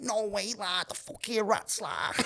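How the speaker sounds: imitated Scouse, lightly put on; thick Scouse accent. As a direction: do a rock singer's heavy Scouse in a retelling.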